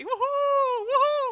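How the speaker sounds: very high, loud gleeful whooping; fearless child's whoop. Retold or imitated. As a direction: whoop like a kid loving the ride. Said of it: "Sama sekali nggak takut, dia tuh kayak gini".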